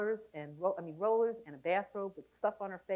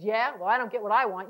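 a louder, defiant retort; retorting husband; 8 dB louder than usual. Retold or imitated. Husband stuck in a stalemate retorts louder. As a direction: snap it back like a stubborn husband.

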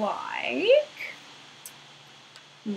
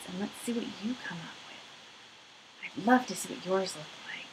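whisper; 2 semitones lower, 11 dB quieter than usual. Acted. Encouraging kids softly.

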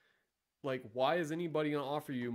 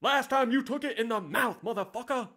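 high, loud yell at the trainee; retold shout; 9 semitones higher, 7 dB louder than usual. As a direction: repeat the instructor's outburst. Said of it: "Ice cold A C in your face".

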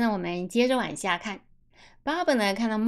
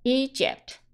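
"Egypt" with a higher, long first "E"; stress on first syllable; 4 semitones higher than usual. Shown as a demonstration. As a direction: punch the opening syllable of "Egypt". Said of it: "重音就变了". (“Egypt”重音放在第一个音节)

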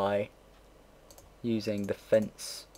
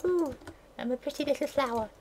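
much higher, prissy mock girly voice; mock delicate girl. Mocking the tiny girl on the bus, high prissy voice. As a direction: send up a dainty little flower. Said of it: "acting all delicate".

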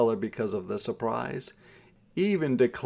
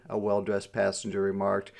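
usual speaking voice

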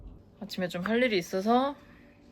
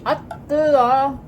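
drawn-out gasp at scalding noodles; huffing around a hot bite; mouth full of hot food; 6 semitones higher, 6 dB louder, much slower than usual. Real, not acted. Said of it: "제가 뜨거운 걸 잘 못 먹거든요". (뜨거운 음식을 입에 문 채로)

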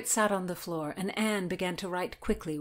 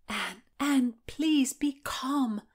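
higher, slow, half-whispered plea; soothing; 6 semitones higher, much slower than usual. A narrator's reading. Margaret begs Anne to be calm, soothing. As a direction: hush her gently and slowly. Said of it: "Margaret soothed".

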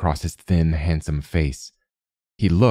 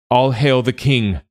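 high, slow ceremonial cry; drawn-out, raised-pitch hail; crowd's acclamation; 7 semitones higher, 4 dB louder than usual. A narrator's reading. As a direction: cry it out as a whole court hailing its king. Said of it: "they cried as one".

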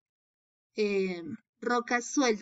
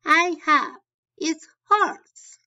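loud voiced reply; 6 semitones higher, 7 dB louder than usual. [name] answers, voiced loudly.

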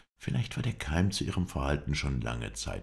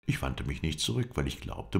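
plain reading voice

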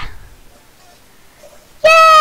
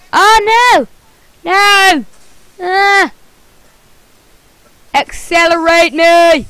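ordinary talk, nothing marked

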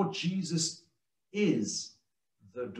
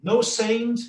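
normal talking voice

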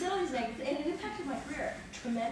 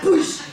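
much louder, higher "Boosh!" like a hit landing; loud punch sound effect; 5 semitones higher, 15 dB louder than usual. Retold or imitated. Jamming the award into his gut, loud punch noise. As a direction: slam the sound out. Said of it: "I'm like".

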